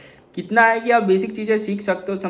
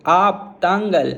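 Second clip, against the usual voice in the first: slow Tamil demo; much slower than usual. Shown as a demonstration. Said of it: "इसको मैं तमिल में कैसे बोलता हूँ?".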